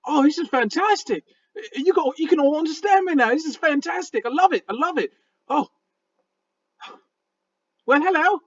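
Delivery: high, delighted critter voice; ecstatic raccoon. Acted. Raccoon in his friend's arms, finally understood, high and ecstatic. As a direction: gush in a squeaky creature voice, overjoyed. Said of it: "Princess seems ecstatic in your arms".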